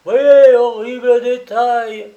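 hoarse hawker's cry. Acted. Hawker hops before the client, crying the headline hoarsely. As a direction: scrape the voice raw as you cry the headline. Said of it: "clameurs éraillées".

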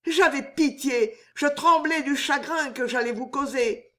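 high-pitched, offended tirade; indignant outburst. A narrator's reading. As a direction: rebuke him like an outraged young woman. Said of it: "pourpre d'indignation".

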